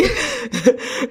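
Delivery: a quick amused chuckle; short real laugh. Real, not acted. Host laughing at the money joke, amused chuckle.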